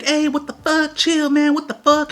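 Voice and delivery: high-pitched, imitated female outcry; loud girlfriend. Girlfriend running over to the fight, high mimicked yelling. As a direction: mimic her yelling to break it up. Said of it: "She's all being loud as fuck".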